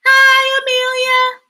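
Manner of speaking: high, loud sing-out hello; group greeting. Shown as a demonstration. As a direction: lead a class of children greeting a newcomer. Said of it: "Everybody say hi to Amelia".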